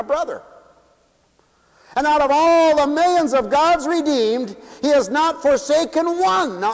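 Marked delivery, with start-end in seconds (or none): 1.9-6.6 preaching. Acted. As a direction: drive it home to the congregation. Preacher's high climax.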